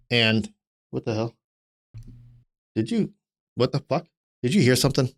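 hushed, startled questions; suddenly alarmed by a noise. Real, not acted. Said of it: "I just heard something weird".

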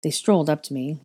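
ordinary delivery, nothing marked

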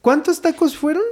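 high, light imitation of dad; dad asking. Papá pregunta al mesero la cuenta, voz más aguda. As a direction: ask the bill question a touch high. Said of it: "se pide la cuenta y dice".

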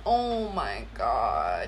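low, slow laugh into oh my God; laughing groan. Real, not acted. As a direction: sink into an embarrassed laugh. Remembering embarrassing date.

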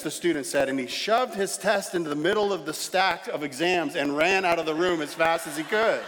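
usual speaking voice

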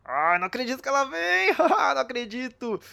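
high, loud, laughing disbelief; giddy excitement. Acted. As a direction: squeal with delight. Ecstatic she is coming.

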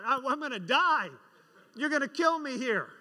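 put-on scared boy sure he will die; panicked child. Boy to his dad on the cliff, terrified cry.

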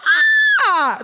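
high loud screaming cry of shock; overjoyed shriek. Retold or imitated. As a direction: relive the moment you heard the news. Reacting to winning the grant.

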